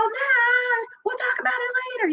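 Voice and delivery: high, fast, breezy dodge; brushing off her son's question. Retold or imitated. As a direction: toss "we'll talk about it later" off quickly and lightly. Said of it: "trying to blow it off with smiling".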